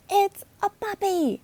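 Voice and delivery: excited reveal. Acted. Emily proudly shows Logan her new puppy, thrilled. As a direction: burst out with delight.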